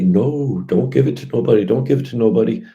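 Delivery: loud, mocking imitation of a politician's refusal; mocking politicians. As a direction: parrot them with a loud sneer. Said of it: "they're gonna say".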